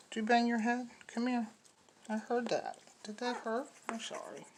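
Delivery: high cooing sympathy for a bumped puppy. Real, not acted. Breeder comforting a puppy that bumped its head, high cooing baby talk.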